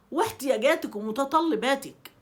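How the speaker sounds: normal talking voice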